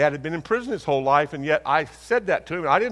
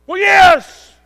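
loud, much higher indignant yes; defensive shout; 13 semitones higher, 12 dB louder than usual. Retold or imitated. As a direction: bristle and shout it back. Husband answers defensively.

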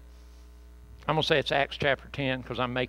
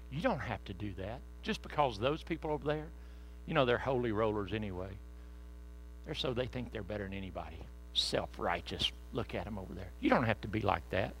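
a hint of a sly tempter, softer; lightly voiced devil, hushed and coaxing; devil's voice; 5 semitones lower, 8 dB quieter than usual. The devil tempting churchgoers away, quiet sly coaxing. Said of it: "that says".

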